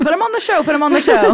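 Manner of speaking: high, loud excited tease; excited teasing. Real, not acted. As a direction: chant it like a giddy friend. Teasing chant about River.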